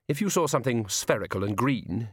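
ordinary delivery

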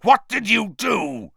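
loud, high furious demand; bellowing. A narrator's reading. Professor beside himself confronting a student, bellowing. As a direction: bellow it at the culprit. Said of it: "he bellowed".

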